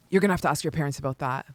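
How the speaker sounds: soft, quick, deadpan brush-off; dry deadpan. Retold or imitated. Older sister quietly deflecting her little sister, dry and fast. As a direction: mutter it like you're done with this.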